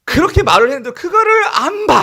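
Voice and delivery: high, loud lament; anguished outcry. Real, not acted. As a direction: cry it out in frustrated heartache. Preacher laments those who would not look. (높고 크게 안타까워하며 절규)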